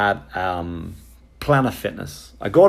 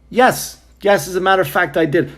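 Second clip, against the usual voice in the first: high, loud mock-eager admission; sarcastic yes; 8 semitones higher, 4 dB louder than usual. Answering the clerk with loud sarcastic yes. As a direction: overplay the yes to mock the question.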